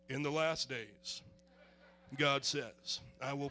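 flat, low, subdued reading of "In the last days"; deliberately unemotional. Shown as a demonstration. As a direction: drain all feeling out, read it low and quiet. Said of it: "Let me try reading it without being emotional".

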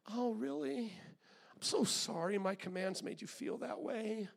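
a hint of a mild, indulgent God; God voiced quiet and easy; softly sarcastic mock God, barely voiced. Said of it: "listening to your story going".

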